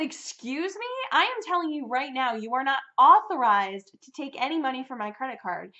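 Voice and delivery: replaying an offended stand against the billing clerk; indignant retort. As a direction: push back hard, offended. Retold fight with billing rep.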